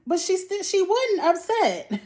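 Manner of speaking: indignant outburst. Retold or imitated. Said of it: "And I'm like".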